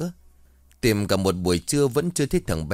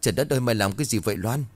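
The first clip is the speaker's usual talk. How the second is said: ordinary delivery, nothing marked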